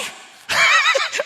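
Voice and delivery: big, high, ringing laugh; loud burst of laughter. Real, not acted. Preacher laughing at the Lord's answer, loud burst. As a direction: let a huge laugh explode.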